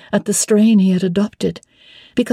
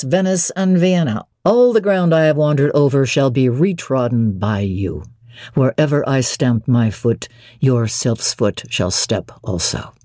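lower, steady male voice for Mr Rochester; 7 semitones lower than usual. Acted. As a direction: drop into the master's lower register, assured. Rochester voiced by female narrator.

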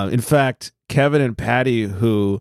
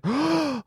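high sharp mock gasp; shocked gasp; 6 dB quieter than usual.

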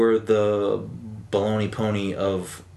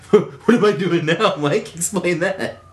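louder and higher, laughing through it; 8 semitones higher, 5 dB louder, slower than usual. Real, not acted. Host laughing as he teases his cohost, louder and higher. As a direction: laugh while daring him to explain. Laughing demand while gesturing.

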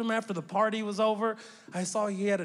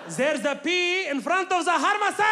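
louder, higher, indignant explaining; indignant uncle; 6 semitones higher, 5 dB louder than usual. Retold or imitated. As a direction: lecture him loudly about the P. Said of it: "He's like".

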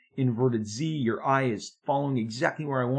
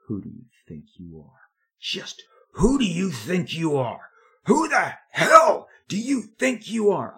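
rising rage at the phone, each line louder; building angry yell; 7 semitones higher, 6 dB louder than usual. Retold or imitated. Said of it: "the call goes like this".

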